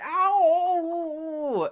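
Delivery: long, high falsetto howl; wolf's pained howl. Acted. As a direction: wail "ow" as a beaten cartoon wolf. Wolf howls after being hit.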